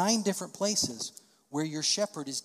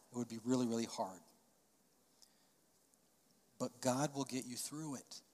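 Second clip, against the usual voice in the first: soft, calm fatherly comfort; gentle reassurance; 4 dB quieter than usual. Retold or imitated. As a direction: ease a frightened son, softly. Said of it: "I said".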